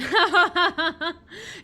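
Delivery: loud, high cackle; real high-pitched guffaw; burst of laughter. Real, not acted. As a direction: laugh out loud in a rapid run. Laughs at the shotgun line.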